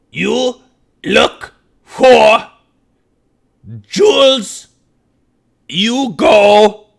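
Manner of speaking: high, loud, halting goblin words; broken Goblin speech. Acted. Old dwarf telling a goblin the way in broken Goblin. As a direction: bark each word out slowly, one at a time. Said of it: "he switches to Goblin".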